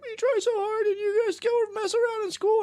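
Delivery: very high tearful squeal; hammed-up weepy scolding; mock crying mom. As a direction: imitate a mom pleading through tears. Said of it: "If my mom would cry".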